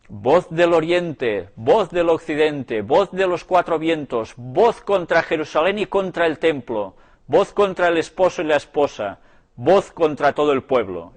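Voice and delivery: a touch of a doom prophet's chant; endecha frenética. A narrator's reading. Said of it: "entonaba la frenética endecha". (endecha de profeta, levemente declamada)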